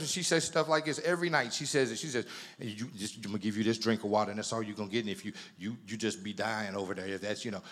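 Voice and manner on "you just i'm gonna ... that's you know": mock unholy wife. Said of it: "real unholy".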